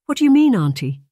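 high, loud puzzled question; confused niece, pitched high and loud. Acted. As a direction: ask it sharply, baffled.

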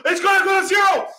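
high, loud mock alarm; mock panic. Mocking panicked sellers during a crash, hammed-up shout. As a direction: ham up the crowd's crash panic.

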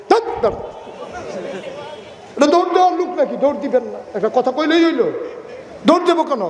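high, scoffing amused reply. Acted. Ibrahim laughing off the police who want to arrest him.

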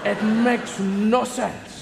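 usual speaking voice

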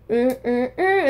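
short hummed horn blasts; mouth-made horn honks, "mm, mm, mm"; imitated horn. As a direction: hum a repeating horn riff. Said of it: "that, like, horn".

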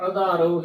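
ਆਧਾਰੋ starting on short "a", like "adhaaro"; wrong short-vowel reading. Shown as a demonstration. Teacher showing the congregation's mistake, ਆਧਾਰੋ with short first "a". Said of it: "ਐੜਾ ਮੁਕਤਾ ਲਗਾ ਦਿੰਦੀਆਂ ਨੇ".

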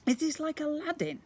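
put-on high, eager boy voice; excited little boy. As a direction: ask it bursting with excitement. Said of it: "asked Ned, excitedly".

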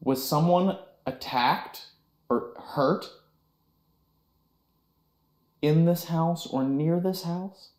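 slow, clear spirit-box question; talking to a ghost. Real, not acted. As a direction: ask the ghost slowly and deliberately.

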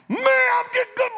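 high, loud mock exasperated shout; mock fury. Preacher imagining losing his temper, a loud high mock shout. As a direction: blow up, half laughing. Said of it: "I'd have been like".

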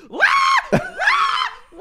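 spaced-out imitation wails; mock freak-out wail. Imitating the cult leader freaking out at the computer, mock wailing. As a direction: wail in separate bursts, mocking a freak-out. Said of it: "remember when she freaks out".

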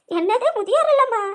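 put-on can't believe the old-age home threat; shocked. Neighbour reacts to the old-age home news, shocked.

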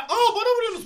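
sharp high cry of alarm at being hit; surprised yelp, pitch jumping up; startled protest. Real, not acted. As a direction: yelp at teammates to stop. Teammates hitting him in game.

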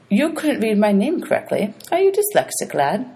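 high, sneering question; mock the boy's reading; lightly voiced sneering ferryman. Charon mocking the boy.

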